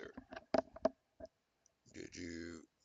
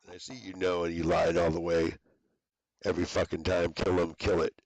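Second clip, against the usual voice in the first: loud ranting threats; 7 dB louder than usual. Real, not acted.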